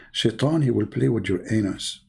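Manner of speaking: ordinary talk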